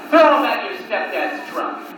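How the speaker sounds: normal talking voice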